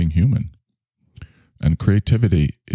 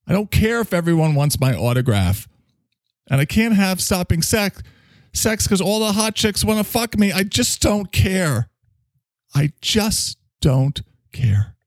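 high, straining mock-defiant tirade; hammed-up outburst, drawing out the last words; mock rant; 12 semitones higher than usual. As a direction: wail it high like a put-upon star. Mock rant about unwanted fame.

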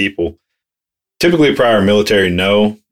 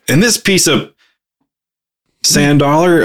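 higher, heated fake-curse outburst; mock heated rant; 7 semitones higher than usual. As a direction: swap the swear in mid-rant. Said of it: "we'll be like".

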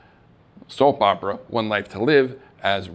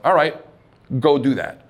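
pep talk; 3 semitones higher, 4 dB louder than usual. Shown as a demonstration. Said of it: "you say to yourself".

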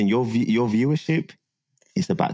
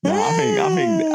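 laughing. Real, not acted. High laughing voice.

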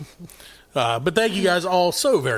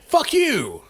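hearty fuck you; 9 semitones higher than usual. Acted.

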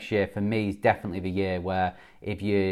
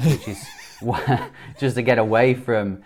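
laughing; 2 semitones higher than usual. Real, not acted. Laughing about escaping to Spain.

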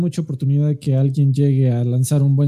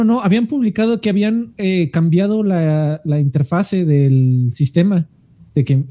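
ordinary delivery

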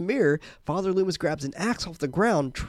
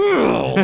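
mock grunt; 8 semitones higher, 8 dB louder than usual. Acted grunt of a figure.

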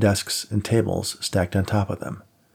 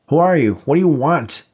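panicked high scream; 7 semitones higher, 5 dB louder than usual. Acted. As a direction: scream it out in terror. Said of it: "Dave screamed".

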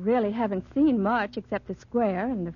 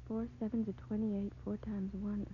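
counting quietly under her breath; muttering to herself; 12 dB quieter than usual. Acted. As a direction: mutter the sums to yourself. Calculating rent under her breath.

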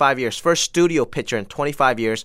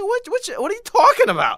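baffled disbelief. Retold or imitated. Baffled reply to angry tweets.

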